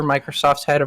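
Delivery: ordinary talk, nothing marked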